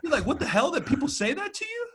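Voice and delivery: incredulous laugh while quoting himself; laughing disbelief. Retold or imitated. Retelling outrage at sexist remarks.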